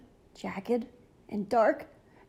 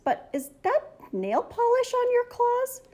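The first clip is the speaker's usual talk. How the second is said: surprised question; 13 semitones higher than usual. Acted. Boy surprised by nail polish.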